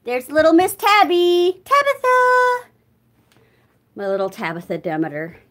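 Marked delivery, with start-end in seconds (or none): 0.0-2.7 high, loud sing-song pet call. Real, not acted. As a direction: sing out fondly to the cat. Calling to her cat.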